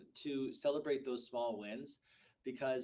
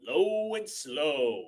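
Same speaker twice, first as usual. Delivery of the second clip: slow demo; 8 dB louder, much slower than usual. Shown as a demonstration. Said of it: "you wanna have a low and slow voice".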